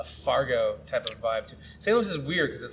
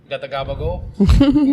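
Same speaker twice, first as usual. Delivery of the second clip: mock Staten Island accent; 11 dB louder, slower than usual. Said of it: "Staten Island accent joke".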